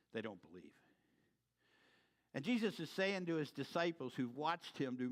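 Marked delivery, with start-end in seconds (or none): 0.1-0.7 quiet repeat. Shown as a demonstration.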